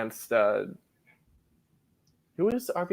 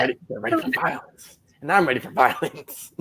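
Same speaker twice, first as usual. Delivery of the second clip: mock hyped; 4 semitones higher than usual. Hyping the match, playful.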